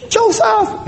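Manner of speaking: a high, sharp call of his name; mock angry call. Husband mimicking his wife calling his name, high and sharp.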